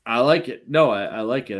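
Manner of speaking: ordinary talk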